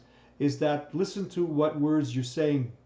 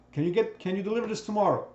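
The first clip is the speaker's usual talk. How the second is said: quick, higher customer question; demanding customer; 6 semitones higher, faster than usual. Retold or imitated. Sales coach voicing a customer asking for delivery, quick and higher. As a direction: fire the request off fast like a pushy client.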